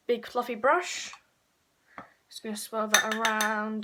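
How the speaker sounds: ordinary talk, nothing marked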